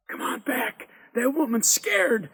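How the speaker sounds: high-pitched, worried calling; anxious call. A narrator's reading. Lester calling his friend back from scared woman, anxious high call. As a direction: call him back with rising alarm.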